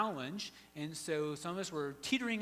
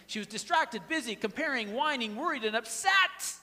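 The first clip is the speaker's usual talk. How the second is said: each word climbing higher and louder until a near shout on upset; building list; 7 semitones higher, 8 dB louder than usual. Shown as a demonstration. Pastor repeats Martha's faults to the crowd, building to a loud climax. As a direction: build the list from firm to a loud high peak. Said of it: "I'll say 'em again".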